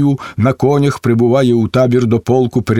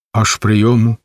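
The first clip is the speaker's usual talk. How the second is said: ordinary delivery, nothing marked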